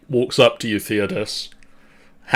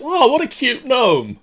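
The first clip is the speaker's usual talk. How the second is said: cooing; 13 semitones higher than usual. Acted. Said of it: "Kalen says".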